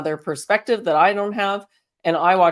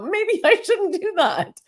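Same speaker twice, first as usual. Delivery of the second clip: hesitant; 12 semitones higher than usual. Retold or imitated. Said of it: "You might hesitate and go".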